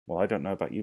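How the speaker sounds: ordinary talk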